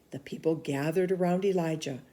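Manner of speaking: ordinary delivery, nothing marked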